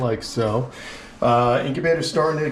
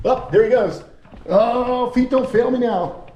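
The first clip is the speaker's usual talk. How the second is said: high, laughing while scrambling after it; real amused panic, pitched up; laughing chase; 10 semitones higher than usual. Real, not acted. Chasing the escaped lizard, laughing.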